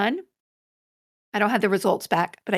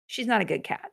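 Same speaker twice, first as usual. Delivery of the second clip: normal talking voice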